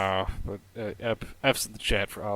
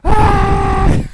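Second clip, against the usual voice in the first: anguished yell; 17 dB louder than usual. Real, not acted. Frustrated at death scene.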